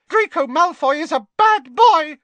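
an elf squeaking in outrage; angry squeak. A narrator's reading. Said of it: "squeaked Dobby angrily".

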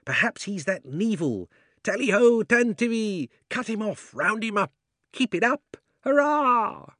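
high, loud, gleeful tally-ho; excited hunting cries. Acted. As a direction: whoop like hunters on the chase. Animals cheering a hunt.

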